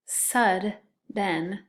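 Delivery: hap-py split into two beats, each syllable tapped. Shown as a demonstration. Teacher to second graders, splitting hap-py into syllables. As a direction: tap out hap-py slowly, one syllable at a time. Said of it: "The next one is hap-py".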